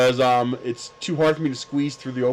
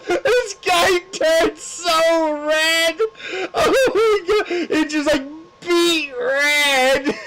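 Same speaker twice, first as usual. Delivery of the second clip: laughing hard; much slower than usual. Real, not acted. Laughing hard telling punchline.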